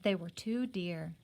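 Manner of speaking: ordinary delivery, nothing marked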